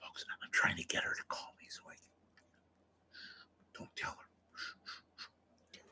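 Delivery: very soft, unvoiced whisper; secretive aside. Host confiding in the folks behind her back, whispered.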